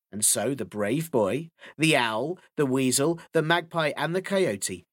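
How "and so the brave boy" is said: ordinary delivery, nothing marked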